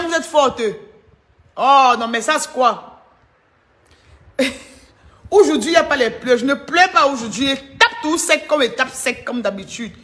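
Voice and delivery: usual speaking voice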